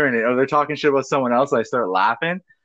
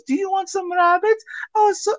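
imitating mom, much higher and sweet-voiced; mom's sugary offer. Son mimics his mother offering neighbors rabbits, high and sweet. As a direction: put on mom's fake-nice offer of rabbits. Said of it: "she goes".

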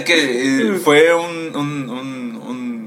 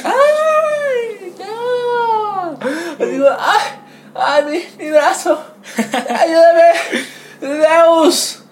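much higher mock old woman crying over her arm; hammed-up old lady wailing; much slower than usual. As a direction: overplay a whimpering grandma in pain. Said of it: "la señora". (anciana llorona exagerada, voz muy aguda)

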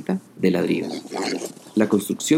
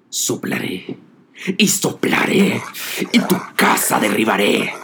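angry wolf. A narrator's reading. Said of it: "se enfureció y dijo".

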